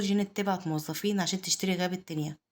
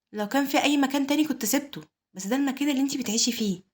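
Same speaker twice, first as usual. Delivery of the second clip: higher, animated pleading; emotive, raised-pitch insistence; earnest appeal; 6 semitones higher, 3 dB louder than usual. A narrator's reading. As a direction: make the case with pitch lifted. Earnest plea to the sister.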